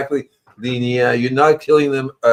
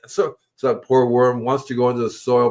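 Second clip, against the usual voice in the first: normal talking voice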